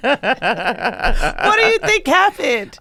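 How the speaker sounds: slow, high, laughing ask; amused, slowed question through laughter. Real, not acted. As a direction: giggle through the question, drawn out.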